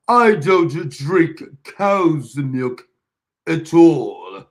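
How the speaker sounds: loud, very slow, over-enunciated British; mock proper British. Host imitating Clever answering him, emphatic mock-British accent. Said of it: "Miss British".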